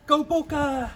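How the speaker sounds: shouted Go Bokke! with a big lift in pitch; rugby cheer. Shown as a demonstration. Rugby fan cheering the Springboks, shouting Go Bokke!. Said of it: "And how do we say it?".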